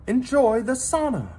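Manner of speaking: inviting. A narrator's reading. Gilda inviting the Vikings into the sauna, high and welcoming. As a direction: invite the crowd in gladly. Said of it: "Gilda invited them".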